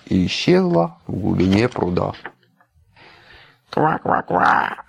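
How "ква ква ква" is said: slow croaking ribbit laugh; laughing frog croak. A narrator's reading. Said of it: "рассмеялась лягушка". (лягушачье кваканье)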